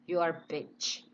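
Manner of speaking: normal talking voice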